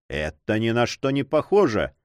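ordinary delivery, nothing marked

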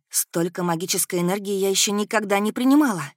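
usual speaking voice

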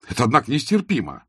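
exclaiming it's unbearable; exclaimed. A narrator's reading. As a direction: cry out in protest. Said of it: "воскликнул Аркадий".

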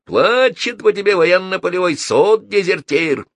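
heated high-pitched shouting; yelling. A narrator's reading. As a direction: yell the accusation back at him. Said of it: "заорал Фрэнки".

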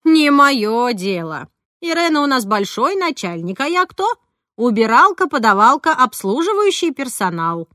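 loud, high, self-belittling protest; indignant older-woman character. Acted. Roza refuses to help persuade the boss Irena, loud and indignant. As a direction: protest loudly, stretching the ends. Voices Roza Vasilyevna in story.

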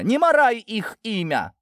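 high-pitched angry cry. A narrator's reading. Lidia defending her sons' name, crying out. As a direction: shout it as an order. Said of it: "выкрикнула Лидия".

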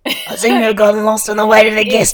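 loud, put-on Australian character voice; Australian accent. Yabai excusing himself to Xia, loud mock Australian accent. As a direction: play it up in a hammed-up Australian accent. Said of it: "having that accent".

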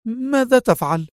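high woman's voice; startled protest; alarmed cry. A narrator's reading. Woman sees him rise from bed, high and alarmed. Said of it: "فهتفت في انزعاج".

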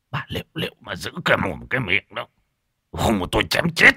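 quick, menacing snarl in her ear; hissed threat. Acted. Husband hissing a threat into wife's ear. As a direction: threaten her close and fast. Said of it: "ghé vào tai vợ mà rít lên".

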